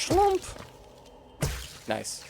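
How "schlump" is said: mock sound effect. Imitated sound in game.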